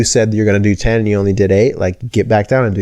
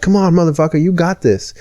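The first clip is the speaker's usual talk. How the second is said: higher-pitched hype-man pep talk; intense motivational speaker; 9 semitones higher than usual. Retold or imitated. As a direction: pump yourself up like a fired-up motivator. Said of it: "like, intense people".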